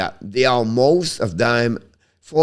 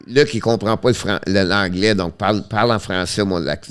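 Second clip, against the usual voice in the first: normal talking voice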